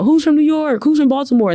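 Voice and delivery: high-pitched crowd call imitation; yelling out. Student recalling campus crowds yelling out hometowns, imitated yelling. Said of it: "they're yelling out".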